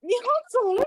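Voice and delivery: high, loud shooing; exasperated. Real, not acted. Teacher telling the interrupter to go, exasperated. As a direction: send them off at high pitch.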